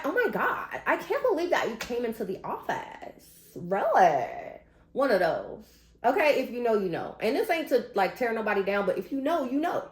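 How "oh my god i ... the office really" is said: mild mock Valley Girl accent, higher at the start; lightly hammed-up Valley Girl accent, gushing; imitating a coworker's forced Valley Girl accent. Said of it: "Valley Girl accent".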